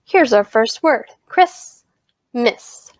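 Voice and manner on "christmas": "Christmas" in two separate beats; split into syllables. Shown as a demonstration. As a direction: say "Christmas" with a pause between syllables. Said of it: "listen very carefully to how I say those".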